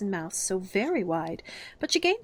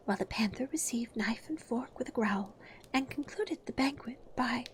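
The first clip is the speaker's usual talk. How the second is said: soft, trembling whispered verse; 5 semitones higher, 5 dB quieter than usual. Acted. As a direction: recite timidly, voice trembling. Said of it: "in a trembling voice".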